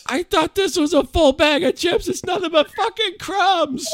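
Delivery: high falsetto impression of the wife complaining; hammed-up wife griping; mock wife. Wife complaining about empty bag, high mock whine. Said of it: "She's like".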